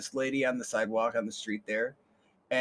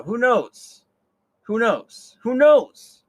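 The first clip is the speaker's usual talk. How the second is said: rising who knows; 8 semitones higher, 10 dB louder, much slower than usual. Acted. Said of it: "repeated question".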